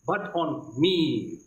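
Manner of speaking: ordinary delivery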